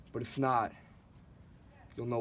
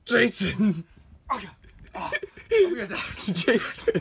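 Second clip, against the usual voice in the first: shrieking with laughter; laughing shout; 10 semitones higher, 11 dB louder than usual. Real, not acted. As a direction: yell it while cracking up. Friend jumps into snow.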